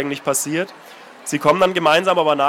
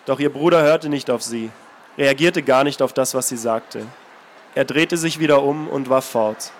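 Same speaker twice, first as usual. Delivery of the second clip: calm, measured reading of a novel; story narration; 3 semitones lower than usual. A narrator's reading. Author reading his novel aloud, steady narration. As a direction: read the passage steadily, letting it speak.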